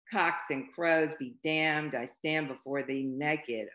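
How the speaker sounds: slow loud proclamation; yelled. Acted. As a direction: shout it out the window slowly.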